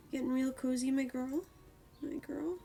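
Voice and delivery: high cooing questions to a cat; pet voice. Real, not acted. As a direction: coo at her with a lift at the end.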